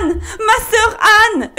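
very high, wailing call; desperate cry. A narrator's reading. Poor wife calling up to her sister, desperately. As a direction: cry out to someone far above. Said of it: "hurla la pauvre femme".

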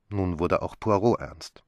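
ordinary delivery, nothing marked

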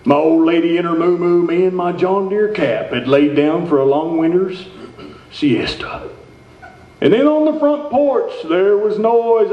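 rhyming Christmas poem read with a twang; storyteller reading a holiday poem. Acted. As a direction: recite the parody poem with relish. Comedic Christmas poem recital.

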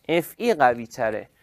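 ordinary delivery

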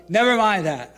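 firm retort. Retold or imitated. Angry retort to thief.

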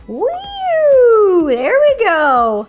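loud, stretched-out whoop; gleeful cheer. Real, not acted. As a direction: cheer on the roaring mixer. Cheering as mixer starts.

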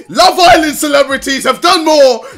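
high, loud, laughing outburst; shouted protest. Real, not acted. Streamer protests he is no celebrity, high laughing shout. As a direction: yell your innocence, half laughing.

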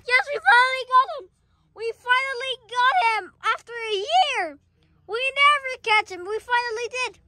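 high, elated cheering; triumphant excitement. Pretend. Catchers celebrating after a year, high and triumphant.

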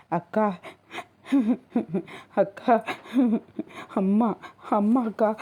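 put-on a little girl sobbing for her mother; calling out between sobs; crying child. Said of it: "அழுது கொண்டிருந்தாள்".

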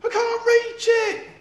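slow, high, loud desperate call. Acted. Eric calling down from the tree, desperate. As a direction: stretch every word out across the gap. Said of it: "called Eric".

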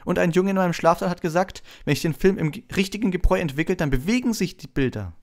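put-on high, loud, eager boy; excited high-pitched chatter; enthusiastic Colin. A narrator's reading. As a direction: share it with breathless fan enthusiasm. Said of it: "holte vor Begeisterung tief Luft".

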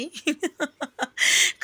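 high, bright chuckle; high chuckle, teasing the bird; light laugh. Real, not acted. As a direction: laugh at your own joke. Laughing at her own teeth joke.

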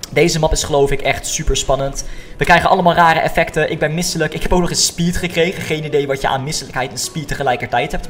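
ordinary talk, nothing marked

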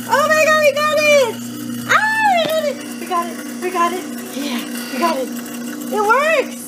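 high, loud shrieks of joy; thrilled cheering. Real, not acted. A mother cheering after fixing the sink alone, high and loud. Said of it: "I'm so excited we did it".

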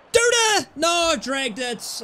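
high shout falling to dismay; disappointed shout. Real, not acted. Gamer dragging his shot wide, frustrated shout. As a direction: yell then groan at the miss.

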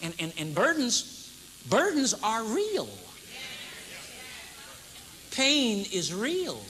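each word weighed, slow and heavy; slow solemn preaching; slow emphasis. Real, not acted. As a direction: lean on real, take your time.

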